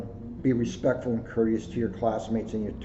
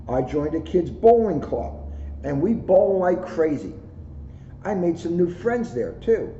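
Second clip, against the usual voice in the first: put-on bright, lively storybook read in a child's voice; reading to children; 5 semitones higher, 3 dB louder than usual. A narrator's reading. Officer reading a picture book to schoolkids, lively storybook voice. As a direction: read it like a proud kid telling his story. Reads kids' book aloud.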